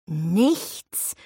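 stretched word, slowly rising at the end; drawn out. A narrator's reading. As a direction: drag the word out questioningly. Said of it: "sagte Ravi gedehnt".

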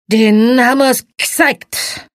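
put-on slow, loud, slurring drunk; drunken slur. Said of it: "lallte er".

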